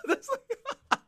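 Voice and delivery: giggling far up high; high laughter. Real, not acted. Host cracking up at the story, high giggles. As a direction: break up laughing.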